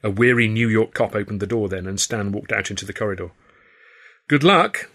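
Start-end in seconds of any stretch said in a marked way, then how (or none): 4.3-4.9 called out after him, higher and louder; calling out. A narrator's reading. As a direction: call it out as the door shuts. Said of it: "I called as the door banged".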